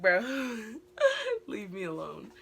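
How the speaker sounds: giggling plea to be left alone; fed-up new girl laughing at her classmates; laughing protest. Retold or imitated. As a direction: laugh through "Bro, leave me alone".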